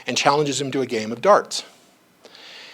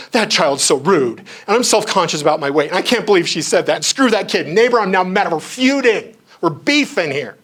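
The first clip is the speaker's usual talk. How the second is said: indignant rant building higher and louder; mock shame rant; 5 semitones higher, 6 dB louder than usual. Imagining a shamed reaction to the child's remark, mock-outraged rant. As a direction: work yourself up into a hammed-up feud. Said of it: "if I had done the shame thing".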